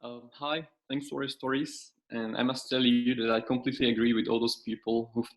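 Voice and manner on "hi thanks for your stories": ordinary delivery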